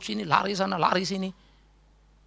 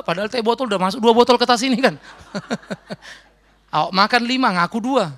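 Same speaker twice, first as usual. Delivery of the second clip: ordinary talk, nothing marked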